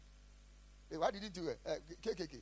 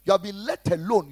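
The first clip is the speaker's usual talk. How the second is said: louder, hammered declaration; loud rebuke; 15 dB louder than usual. Acted. Pastor loudly declaring the congregation spared. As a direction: proclaim it loudly to the room.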